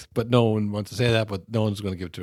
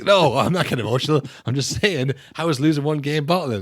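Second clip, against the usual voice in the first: higher, louder indignant protest in a put-on voice; defensive rival fan; 7 semitones higher, 4 dB louder than usual. Fan arguing back online, high indignant protest. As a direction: play the fan who insists he's not upset. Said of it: "It's like".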